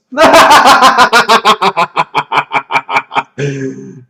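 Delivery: loud villainous cackle; evil laugh. Pretend. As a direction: laugh like a triumphant tyrant. After villain proclamation.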